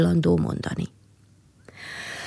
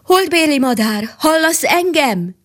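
calling out; 7 semitones higher than usual. A narrator's reading. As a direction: call up to the moon bird. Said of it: "Kiáltotta a király".

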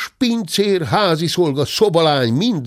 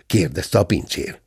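usual speaking voice

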